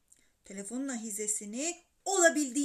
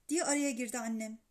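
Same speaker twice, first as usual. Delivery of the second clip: usual speaking voice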